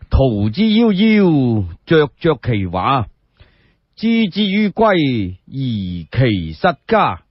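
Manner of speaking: drawn-out classical chanting, each phrase stretched; slow poem recitation. Acted. Confucius reading an ode off bamboo slips, slow chanted recitation. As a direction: intone the old ode slowly, savouring every word.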